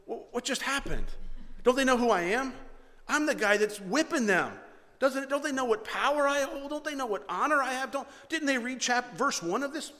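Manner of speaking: high, loud, offended rant of a proud general; indignant outburst. Retold or imitated. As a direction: play Naaman stunned and insulted, voice climbing. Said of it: "picture Naaman standing there going".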